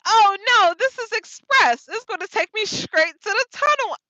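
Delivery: high, loud panicky blurt; replaying the moment of panic; panicked. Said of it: "I freak out".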